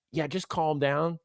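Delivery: soft, slow, drawn-out hush; mock reassurance. Fans telling the host to settle, mock soothing. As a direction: talk someone down, quiet and slow.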